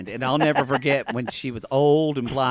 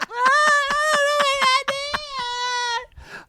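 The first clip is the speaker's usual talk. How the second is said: mock-whiny excuse; whiny high voice. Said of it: "she went".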